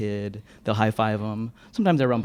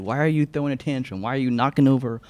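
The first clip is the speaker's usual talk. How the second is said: higher-pitched questioning grown-up; exasperated grown-up; 4 semitones higher than usual. Retold or imitated. Library grown-ups questioning kids about feelings, exasperated. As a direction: imitate an adult quizzing a child, pitched up. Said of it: "library grown-ups are like".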